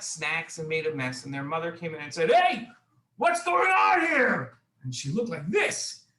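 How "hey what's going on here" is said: high, loud scolding mom yell; mean yell. Acted. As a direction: yell at the kids, stretching the words out. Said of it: "really mean".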